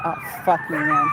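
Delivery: sudden startled curse, last word jumping up. Real, not acted. Walker spotting coyotes in the dark, startled curse. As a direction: caught off guard by coyotes.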